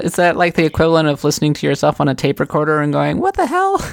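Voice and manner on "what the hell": high-pitched, stunned disbelief; drawn-up, mock-horrified question; incredulous reaction. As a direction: squeal it as if appalled by your own recording. Imagined reaction to own recorded voice.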